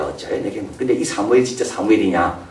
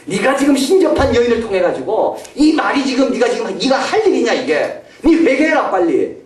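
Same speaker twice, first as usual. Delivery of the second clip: scolding Samuel; 10 semitones higher than usual. Retold or imitated. 사울을 꾸짖는 사무엘, high scolding. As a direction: rebuke him like an angry prophet. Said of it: "아마 야단쳤을 겁니다".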